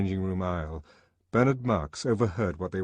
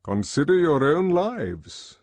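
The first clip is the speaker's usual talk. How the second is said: slow, louder instruction; slow commanding address; 8 semitones higher, 7 dB louder than usual. A narrator's reading. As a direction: make them think. Mustapha Mond addresses boys.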